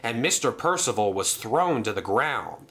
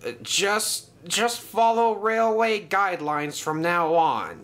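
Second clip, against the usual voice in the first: high, halting, a touch winded; breathless; 7 semitones higher than usual. A narrator's reading. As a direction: stammer it out after being knocked down. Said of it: "he said breathlessly".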